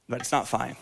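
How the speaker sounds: ordinary delivery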